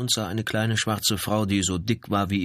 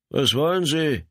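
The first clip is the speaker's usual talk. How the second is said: lightly voiced wary janitor, pitched up; higher, a mild challenge through the door; wary call through door; 8 semitones higher, 3 dB louder than usual. A narrator's reading. Janitor to police knocking at his door, mildly wary.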